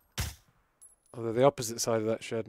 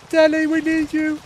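shouting; 10 dB louder than usual. Real, not acted. Calling teammate for help.

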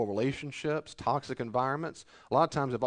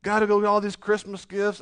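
higher, louder whiny sigh of a complainer; mock whining complainer; 7 semitones higher, 9 dB louder than usual. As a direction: whine about the gifts like a spoiled shopper. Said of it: "they'll complain Christmas time".

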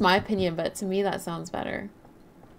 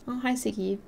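usual speaking voice

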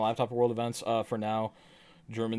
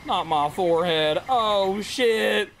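mock pained yells; 11 semitones higher, much slower than usual. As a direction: yelp as if struck in the head. Pain reaction shouting.

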